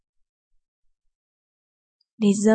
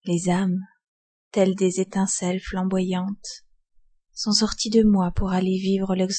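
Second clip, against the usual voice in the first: ordinary delivery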